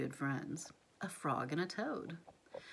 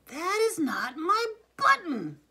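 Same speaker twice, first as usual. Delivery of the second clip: high, loud, slow yell, landing hard on "button"; furious shout; 10 semitones higher, 11 dB louder than usual. A narrator's reading. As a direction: shout it in a completely different voice, much higher and louder. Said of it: "shouted Toad".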